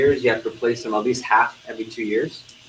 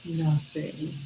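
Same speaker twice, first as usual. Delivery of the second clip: sad; 4 semitones higher, 10 dB quieter than usual. Acted. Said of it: "If they're sad".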